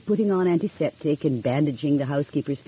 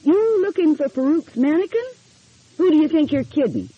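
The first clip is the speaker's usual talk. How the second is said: put-on much higher, cackling old man's voice; high, louder mocking old man; high-pitched old man; 12 semitones higher, 4 dB louder than usual. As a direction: squeak it out like a wizened old man mocking her. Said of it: "In a high-pitched voice".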